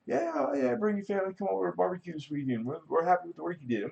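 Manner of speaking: high, quick imitation of a cheery neighbor; chirpy friendly client. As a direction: play the overly cheerful guy inviting him over, quickly. Imitating friendly clients inviting him.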